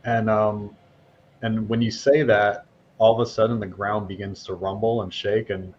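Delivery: usual speaking voice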